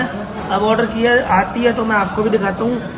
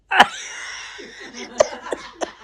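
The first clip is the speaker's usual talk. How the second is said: breathy laugh; 6 dB quieter than usual. Real, not acted. Laughing at Pari's hidden money.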